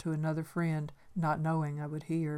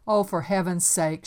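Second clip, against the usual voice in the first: exasperated; 2 semitones higher, 9 dB louder than usual. A narrator's reading. Great aunt scolds shy girl.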